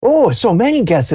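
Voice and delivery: put-on high delighted old man; old grandfather. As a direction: marvel at the crowd like a fussy grandpa. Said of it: "He's like".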